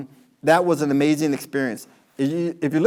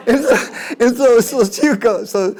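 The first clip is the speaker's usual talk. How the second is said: laughing while talking; 8 semitones higher, 7 dB louder than usual. Real, not acted. Laughing through wig story.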